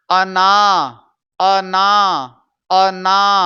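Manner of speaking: अना stretched evenly, final "r" dropped; loud, level "a-naa", syllables held; say-along breakdown. Shown as a demonstration. Teacher breaking the word down for students, drawn-out syllables. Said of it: "इसे बोलिएगा मेरे साथ".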